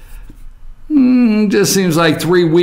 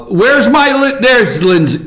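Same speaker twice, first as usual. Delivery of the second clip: normal talking voice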